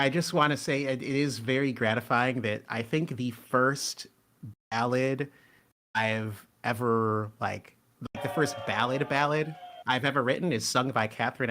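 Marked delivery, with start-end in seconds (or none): none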